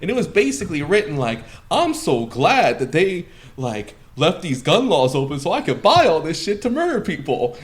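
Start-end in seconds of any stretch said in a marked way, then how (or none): 1.7-7.5 high, mocking paraphrase; sneering mock quote. Mocking the manifesto's tone.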